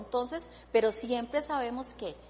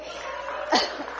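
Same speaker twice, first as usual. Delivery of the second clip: happy laugh. Real, not acted. Overjoyed at writing again.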